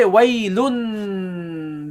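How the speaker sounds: "waylun" with the final n hummed long; held final noon. Shown as a demonstration. Teacher shows what you would normally say, held noon. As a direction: draw out the n that ends "waylun". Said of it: "the noon at the end".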